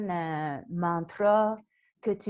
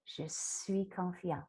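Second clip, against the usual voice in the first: mantra doux; 2 semitones lower, 10 dB quieter, slower than usual. Shown as a demonstration.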